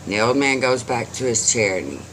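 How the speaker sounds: usual speaking voice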